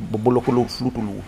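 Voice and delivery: Bobolokulu Flutulu, vowels between all consonants; Japanese pronunciation. Shown as a demonstration. Explaining a loanword, he says Bobolokulu Flutulu Japanese style. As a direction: Bobolokulu Flutulu, every syllable sounded. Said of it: "immer ein Vokal dazwischen".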